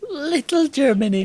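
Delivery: high, teasing put-on voice; playful high-pitched mimicry; mock voice. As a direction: mimic a local in a silly high voice. Mimics the quoted locals.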